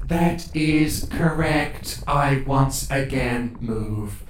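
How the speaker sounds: slow, deliberate machine voice; measured robot, word by word; robot character. Acted. Warforged named Bright confirming it can move, slow robot voice. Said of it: "Bright goes".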